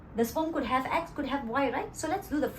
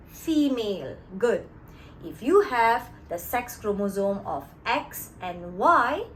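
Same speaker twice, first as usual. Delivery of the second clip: ordinary talk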